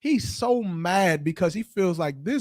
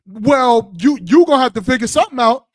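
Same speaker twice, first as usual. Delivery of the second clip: higher, louder fast demand; mock bossy woman; 5 semitones higher, 7 dB louder than usual. Other woman pushing the problem back on the man, higher and louder. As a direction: push it back on him as the woman. Woman voiced in acted quarrel.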